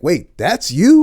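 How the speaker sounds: loud, drawn-out amazed question; retold astonished double take; Jonathan Majors. Acts out co-star's disbelief.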